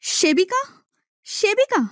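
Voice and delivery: high, plaintive call for the maid. Acted. Blind princess calling her maid, a high plaintive call. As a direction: call her maid from the next room, rising.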